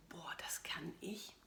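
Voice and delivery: hushed, slow, doubtful whisper. Retold or imitated. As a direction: whisper it in disbelief. Retold moment of self-doubt.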